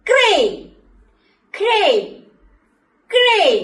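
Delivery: emphatic model word; clear teaching word. Shown as a demonstration. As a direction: call crane out clearly for children. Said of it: "ഏതാണ്?".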